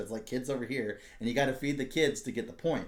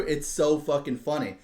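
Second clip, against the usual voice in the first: ordinary delivery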